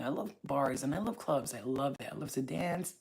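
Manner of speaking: ordinary talk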